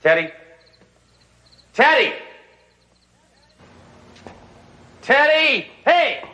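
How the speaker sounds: calling out loud. Acted. Hammer calls out searching for Teddy, loud high yell. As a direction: shout the name high and loud.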